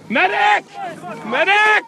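urgent shout for a medic; man down shout. Real, not acted. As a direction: yell for help across the crowd. Injured, calling for help.

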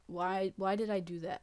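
ordinary delivery

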